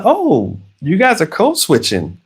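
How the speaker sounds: high-pitched "oh" of discovery; surprised realisation. Retold or imitated. Dad catching his kids code switching, surprised. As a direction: react as if catching them out.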